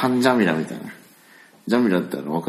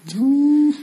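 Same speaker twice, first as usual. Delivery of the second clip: high wailing monster cry; 4 dB louder than usual. Retold or imitated. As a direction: wail it like a crying monster. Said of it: "泣いとるわ". (怪獣の泣き声)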